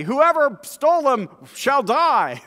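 high, loud, slow proclamation; rash oath. Acted. Jacob vowing before everyone, loud slow declaration. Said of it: "he would have not said in front of everybody".

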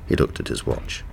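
ordinary delivery, nothing marked

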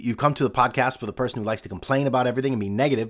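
usual speaking voice

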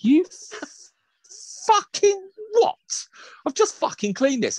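high, strained, slow-building disbelief; acted outrage. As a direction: explode in halting, high-pitched indignation. Outraged reaction to cake landing.

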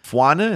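"Fwana" as FWAH-nuh, rising in question; questioning pronunciation. Shown as a demonstration. Asking co-host how the name goes, questioning pronunciation. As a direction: ask "Fwana" with a w glide after the F. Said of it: "Is that how you pronounce that?".

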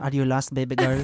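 put-on smooth Filipino accent. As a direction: say it the Manila way, smooth. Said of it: "say it in a Filipino accent".

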